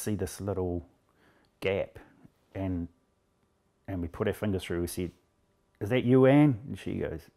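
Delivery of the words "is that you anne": loud, higher call into the rubble. Retold or imitated. Rescuer calling to trapped woman through a gap, loud and high.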